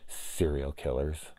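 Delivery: soft, slow, low creepy delivery; imitating an eerie, hushed reply. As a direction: say it slowly and quietly to unsettle. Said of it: "All super creepy and low like that".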